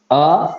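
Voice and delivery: अ said short, one beat; short vowel. Shown as a demonstration. Teacher demonstrating the short vowel, one quick beat. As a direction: clip the अ to a single short beat. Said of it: "एक अ का उच्चारण हो सकता है".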